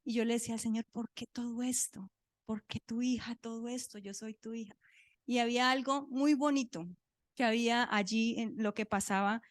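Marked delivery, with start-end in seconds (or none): 0.9-4.8 retold tearful prayer. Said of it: "lloré hasta que me quedé dormida".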